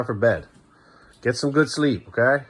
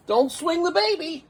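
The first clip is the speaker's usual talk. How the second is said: drawn out, much higher, slow playful scolding; playful warning; slower than usual. Real, not acted. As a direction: stretch it out like a gentle tease. Warning child about baby doll.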